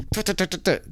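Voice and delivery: quick, soft staccato tuts; imitated buckling noise. Husband mimicking his wife strapping the kid in, rapid tutting noises. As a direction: rattle off little tongue-tap sound effects.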